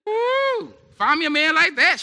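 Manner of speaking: high, loud whoop of admiration; gushing woman. Acted. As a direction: play a woman hollering her approval. Woman gushing over an attentive man.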